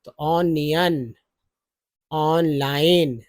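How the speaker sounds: ऑनियन and ऑनलाइन said very slowly, sound by sound; slow word demo. Shown as a demonstration. Teacher sounding out ऑनियन and ऑनलाइन very slowly. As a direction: stretch out ऑनियन and ऑनलाइन for the learners. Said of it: "ओएन को ऑन भी पढ़ेंगे".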